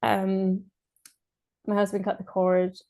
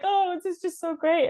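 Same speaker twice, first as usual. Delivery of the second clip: much higher, gushing, lightly voiced husband; a hint of the husband overcome with joy; mock tearful husband; 10 semitones higher than usual. Quoting her husband in tears, with high, lightly gushing joy.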